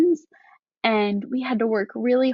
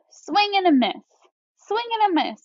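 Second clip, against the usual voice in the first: higher each time; rising excitement; 8 semitones higher, faster than usual. Shown as a demonstration. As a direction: build the tension swing by swing. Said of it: "change my voice to match".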